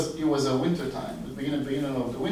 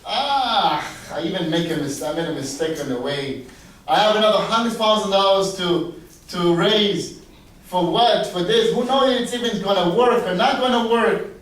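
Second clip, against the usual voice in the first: loud, higher, griping about the cost; complaining; 4 semitones higher, 6 dB louder than usual. Retold or imitated. Doctor voiced as he might have complained about the extra money, loud griping. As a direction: grumble as if fed up. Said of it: "he could have said".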